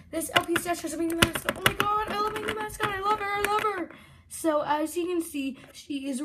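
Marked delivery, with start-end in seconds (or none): 1.6-3.9 high, gushing delight; gushing excitement. Real, not acted. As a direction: gush over her, voice up high. Gushing over new mascot.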